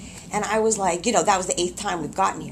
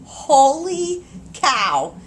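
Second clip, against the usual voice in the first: very drawn-out, louder exclamation; amazed exclamation; 4 semitones higher, 5 dB louder, much slower than usual. Real, not acted. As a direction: stretch it out in higher, emphatic disbelief. Exclaims over remembered intense pain.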